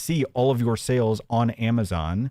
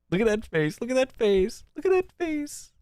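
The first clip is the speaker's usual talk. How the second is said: each repeat climbs higher into a mocking squeal; mocking, rising higher; 10 semitones higher than usual. Mocking the creator's smirk, voice climbing higher each repeat.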